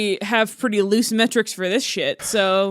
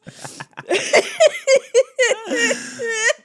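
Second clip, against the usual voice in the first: high, wheezy laughing fit; wheezing laughter; 12 semitones higher than usual. Real, not acted. Co-host dying at the podcast's chaos, wheezing laughter. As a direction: laugh helplessly on little breath. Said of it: "Wheezing to death".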